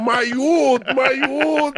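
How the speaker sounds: laughing mock Jamaican. Mimicking Jamaican man, laughing.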